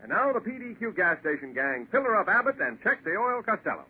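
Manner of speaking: ordinary talk, nothing marked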